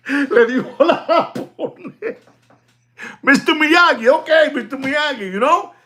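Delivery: high, laughing tease; laughing mockery. Real, not acted. Seller laughing at the rival he calls Mister Miyagi, high and giggling.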